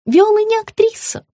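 high-pitched insistent; high sugary woman. A narrator's reading. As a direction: insist sweetly. Margarita repeats.

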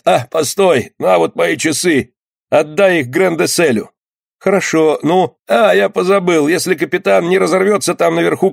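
lightly voiced wounded sailor; dying skipper, giving last errands. A narrator's reading. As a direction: hand over final requests to a friend.

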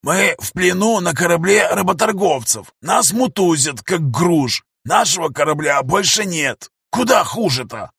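wry bitter complaint. Acted.

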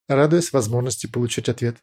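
ordinary delivery, nothing marked

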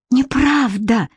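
usual speaking voice

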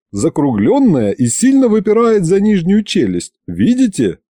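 animated, high-pitched lecturer; eager scientist. A narrator's reading. Scientist shows the fish head to narrator, eager lecturing. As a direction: point out each feature, pressing for agreement.

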